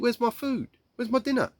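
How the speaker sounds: put-on high-pitched complaining child; high, plaintive mealtime protest; hungry child protesting. Child complaining about an empty plate.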